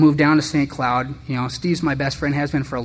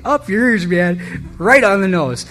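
stunned outburst; 7 semitones higher, 5 dB louder, slower than usual. Retold or imitated. Stunned reaction to friend's jab.

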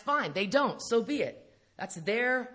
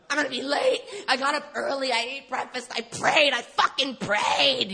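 indignant rant; 5 semitones higher, 5 dB louder than usual. Retold or imitated. Complaining to God about being late, a loud indignant rant. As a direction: protest like you did everything right and got cheated.

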